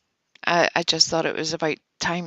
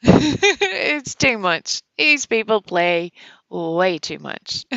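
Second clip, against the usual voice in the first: laughing; 4 dB louder, slower than usual. Real, not acted. Laughing at chat.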